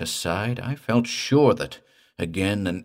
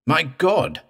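sudden high cry; cry of alarm; 8 semitones higher, 4 dB louder than usual. A narrator's reading. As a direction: cry out in shock. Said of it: "I cried".

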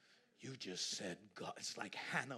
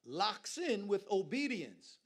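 slow, much louder preaching; climactic preaching; 6 semitones higher, 11 dB louder, slower than usual. Acted. Preacher bringing sermon to climax, slow and loud. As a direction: hammer each phrase slowly.

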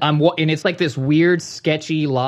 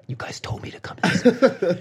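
whispered complaint; 4 semitones higher than usual. Retold or imitated. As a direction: whisper it under your breath. Muttered complaint.

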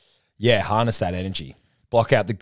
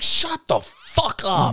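retold outburst; 10 semitones higher, much slower than usual. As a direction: drag out the retort in fake outrage. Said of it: "I was like".

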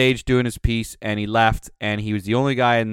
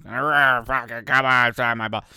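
put-on drunk slur. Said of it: "drunk Coxie".

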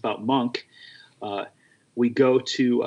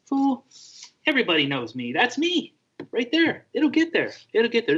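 imitating Monk, pitched way up and unbothered; dismissive Monk; 12 semitones higher than usual. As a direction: brush it off like a cocky star. Monk's dismissive voice.

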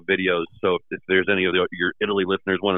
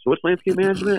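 quizzical guest; 11 semitones higher than usual. Retold or imitated. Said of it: "he was like".

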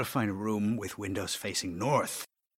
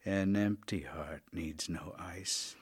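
quiet; 6 semitones lower, 6 dB quieter than usual. Acted. Dying man, low slow.

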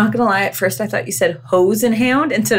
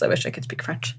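soft, lower near-whisper; wistful hush; 4 semitones lower, 10 dB quieter than usual. Real, not acted. Jealous Canadian wishing for French, hushed aside. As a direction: confide it under your breath.

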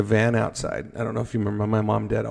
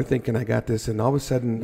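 ordinary talk

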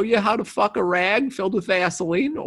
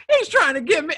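high, pleading whine; mock whiny complaint; 9 semitones higher, 5 dB louder than usual. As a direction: squeak it out like tattling. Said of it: "He goes".

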